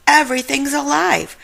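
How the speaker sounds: alarmed cry. Acted. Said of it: "cried Shaggy".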